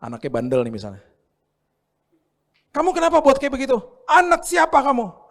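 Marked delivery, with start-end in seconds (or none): 2.7-5.3 much higher and louder, shrill telling-off; angry scolding parent. Acted. Harsh parent yelling at a naughty child, high and loud.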